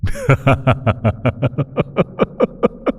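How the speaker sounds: a delighted villainous giggle climbing up; airy, high-pitched demonic titter; gleeful demon laugh. Acted. Demon gloating over its plaything.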